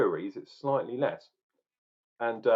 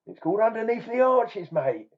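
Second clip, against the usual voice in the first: much higher, louder hammed-up Cockney accent; mock Cockney; 9 semitones higher, 5 dB louder than usual. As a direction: announce it like a cheeky Cockney geezer. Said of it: "am I gonna be talking like this".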